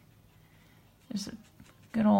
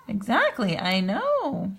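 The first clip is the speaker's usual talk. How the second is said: normal talking voice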